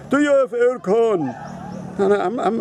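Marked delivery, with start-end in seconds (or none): 0.1-1.3 slow, higher-pitched mimicked question; put-on voice of a guest asking, drawn out; imitating Filipino guests. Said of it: "The first question they ask me is".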